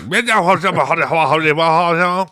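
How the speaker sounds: high, garbled country holler; mock incomprehensible backwoods babble; unintelligible old-timer gibberish. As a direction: imitate a mush-mouthed old man with noises. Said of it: "real hard time understanding, uh, old Bud".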